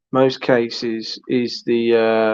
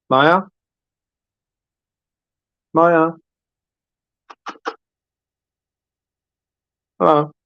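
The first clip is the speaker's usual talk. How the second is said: louder, questioning calls into silence; calling out; 4 semitones higher, 3 dB louder than usual. Real, not acted. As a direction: call out to check if they're still there.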